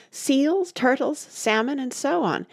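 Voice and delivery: high-pitched, excited listing; excited cry. A narrator's reading. Mock Turtle listing the quadrille dancers, crying out. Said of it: "cried the Mock Turtle".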